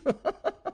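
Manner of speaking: high-pitched mocking cackle; loud laugh. A narrator's reading. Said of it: "with a loud laugh".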